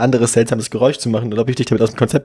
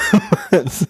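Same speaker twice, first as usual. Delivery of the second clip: squealing laugh; high burst of laughter. Real, not acted. Laughing after the bit.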